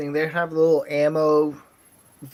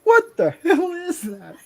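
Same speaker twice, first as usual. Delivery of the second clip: higher, slow, laughing New York lady; startled lady; 12 semitones higher, 5 dB louder, slower than usual. Retold or imitated. Retelling a lady seeing a big rat, startled and laughing. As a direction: play a shocked woman spotting a rat. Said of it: "this lady's like".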